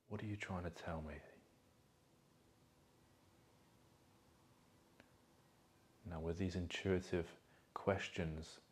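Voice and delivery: soft, calm meditation voice with long pauses; guided meditation. Acted. Coach guiding listeners to feel emotions in their body, guided meditation. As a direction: guide them gently inward, leaving space between phrases.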